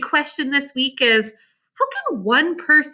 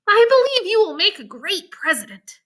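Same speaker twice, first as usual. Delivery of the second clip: put-on higher admiring girl's voice; Jane; 6 semitones higher than usual. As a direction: encourage him like an impressed friend. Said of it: "said Jane".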